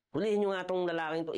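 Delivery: angry order. Retold or imitated. Angry master orders soldiers.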